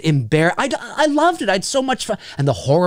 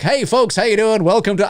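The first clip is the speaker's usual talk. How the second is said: upbeat theater host greeting, a bit higher; professional announcer; 6 semitones higher than usual. Retold or imitated. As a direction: greet the crowd like a polished usher. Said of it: "I was so professional".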